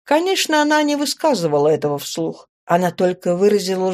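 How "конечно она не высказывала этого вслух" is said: plain reading voice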